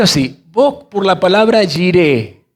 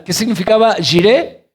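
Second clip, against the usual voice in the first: ordinary talk, nothing marked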